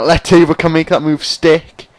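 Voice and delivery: emphatic retake. Real, not acted. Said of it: "so I'll say that again".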